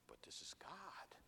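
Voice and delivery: hushed, breathy aside; awed whisper about God. Real, not acted. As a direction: lean in and barely voice it. Awed aside about God's presence.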